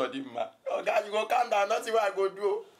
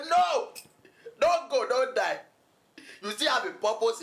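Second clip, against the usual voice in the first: frantic cry; 5 semitones higher, slower than usual. Retold or imitated. Bystanders stop a man jumping off bridge, acted alarm. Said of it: "but people hold me. They say".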